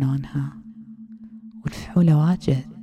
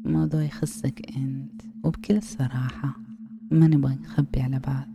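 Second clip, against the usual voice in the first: ASMR whisper. Acted. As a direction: lull him in with a hushed hypnotic whisper.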